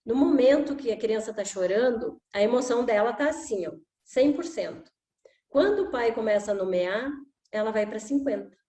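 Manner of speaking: usual speaking voice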